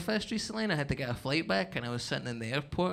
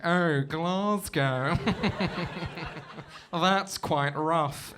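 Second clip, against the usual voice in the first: slow, drawn-out posh English "Oh, Glasgow"; mock posh English; 2 semitones higher, 3 dB louder, much slower than usual. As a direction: stretch "Oh" long like a posh English snob. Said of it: "They're like".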